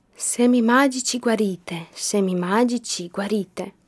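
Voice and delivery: usual speaking voice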